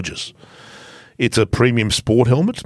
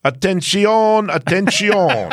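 put-on slow, higher Spanish-accented announcement; 8 semitones higher, slower than usual. Asked by co-host to read in Spanish, slow accented announcement. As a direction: call for attention in a hammed Spanish accent. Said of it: "Can you do Spanish?".

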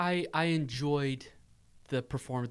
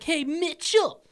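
mocking bully; 3 dB louder than usual. Bully taunting Dennis.

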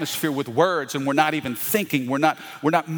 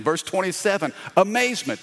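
normal talking voice

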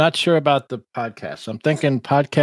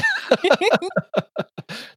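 high, bursting laugh; burst of laughter. Real, not acted. Laughing at the stalking joke.

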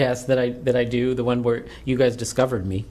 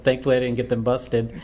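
ordinary talk, nothing marked

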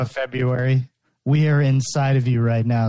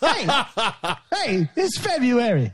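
a rough comic impression, pitched up; Eddie Murphy; 8 semitones higher than usual. Playing February for the co-hosts, badly done celebrity impression. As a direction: lightly attempt a comedian's voice, higher. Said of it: "trying my best Eddie Murphy impression".

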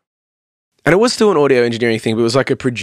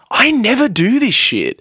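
amazed admission; 10 semitones higher than usual. Retold or imitated. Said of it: "And I was like".

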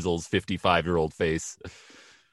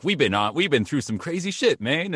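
high-pitched, worked-up movie sidekick; Roman; 7 semitones higher than usual. Acted. Imitating a film character recapping their adventures, high comic voice. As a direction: push the pitch up into comic exasperation. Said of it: "where you get the Roman".

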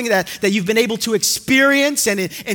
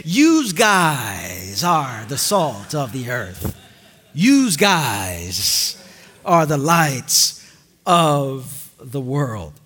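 slow, much lower Jersey voice on Yous guys; Jersey accent; 10 semitones lower, much slower than usual. Shown as a demonstration. Preacher imitating a Jersey Jesus, Jersey accent. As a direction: play a Jersey guy saying Yous guys, low and slow. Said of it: "if Jesus was from Jersey".

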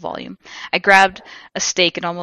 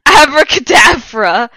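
slow, loud, high hammed-up incantation; yelled spell; 7 semitones higher, 11 dB louder, slower than usual. A narrator's reading. Vampire casting at Snape and Lupin, hammed-up loud yell. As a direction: bellow the magic word. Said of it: "he yelled at Snape and Lupin".

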